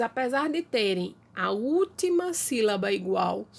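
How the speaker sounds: ordinary talk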